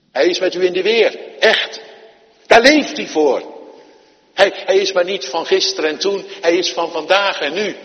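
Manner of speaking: loud, high, ringing proclamation; fervent preacher's climax; preaching at full force. Real, not acted. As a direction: lift it to the back row with conviction.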